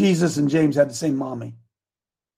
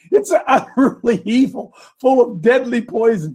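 animated, raised declamation; preaching scripture; 4 semitones higher, 3 dB louder than usual. Acted. Preacher reading James on the tongue, declamatory. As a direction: proclaim it with big peaks and stretched words.

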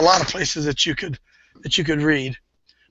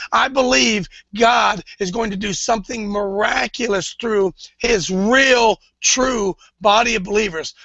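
preaching; 5 semitones higher than usual. Acted. Preacher promising revival to believers, emphatic preaching. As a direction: hammer it home from the pulpit.

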